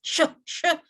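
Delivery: a high, hissed sh sh; sh drill. Shown as a demonstration. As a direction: model the sh sound. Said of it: "pronounce it as sheep".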